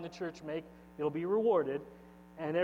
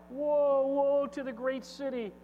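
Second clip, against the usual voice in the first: mourning cry; 7 semitones higher than usual. Acted. Reading merchants' lament.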